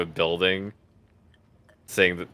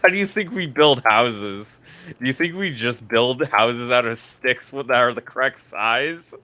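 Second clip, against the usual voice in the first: louder, higher mock disbelief; mock indignant; 6 semitones higher, 7 dB louder than usual. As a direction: play up the outrage. Mock outrage at building logic.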